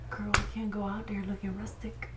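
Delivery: sassy whispered aside. Real, not acted. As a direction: whisper it like gossip.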